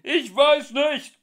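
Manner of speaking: loud, high, slow shout; bellowing back. Acted. Ford yelling back to Arthur, loud and high. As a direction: roar the answer back. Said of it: "brüllte Ford zurück".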